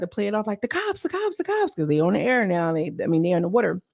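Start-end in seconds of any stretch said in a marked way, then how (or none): 0.6-1.7 high-pitched imitation of a warning cry; mock alarm. Girls pretending they spotted police on the water, mock alarm. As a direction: play-act a quick high heads-up.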